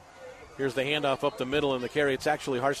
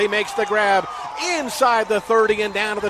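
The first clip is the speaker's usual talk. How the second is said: shouted, higher and much louder, racing with the catch; excited play call; 6 semitones higher, 9 dB louder than usual. Real, not acted. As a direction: sell the big completion at full volume. Big pass play called live.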